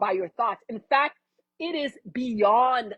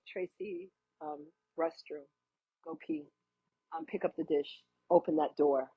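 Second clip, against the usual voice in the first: much softer, low murmured commands; hushed intuition voice; 6 semitones lower, 7 dB quieter than usual. Pretend. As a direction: quietly direct every little move. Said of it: "It would be like".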